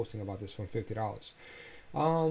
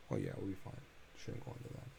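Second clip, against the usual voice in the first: whispered aside; 2 semitones lower, 10 dB quieter, faster than usual. Real, not acted. Muttering to himself at chart.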